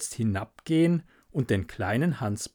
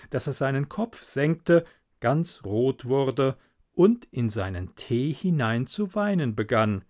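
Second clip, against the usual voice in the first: ordinary delivery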